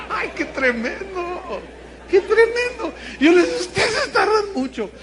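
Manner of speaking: high, loud, through laughter; laughing. Real, not acted. Preacher retelling his own cheek to congregation, helpless laughing. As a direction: barely get it out for laughing.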